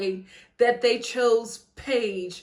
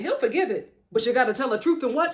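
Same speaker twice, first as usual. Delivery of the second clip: usual speaking voice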